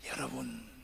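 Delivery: normal talking voice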